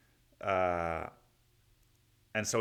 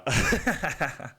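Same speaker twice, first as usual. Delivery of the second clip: higher, louder burst of laughter; amused laugh; 6 semitones higher, 6 dB louder than usual. Real, not acted. Laughing after the [name] jab, amused. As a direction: laugh at your own joke.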